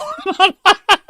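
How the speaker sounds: high, helpless laugh; burst of laughter. Real, not acted. Laughing at the critic's soccer ball joke, a high burst of laughter. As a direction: crack up at the punchline.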